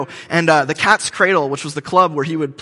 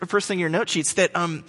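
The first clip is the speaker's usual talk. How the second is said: ordinary talk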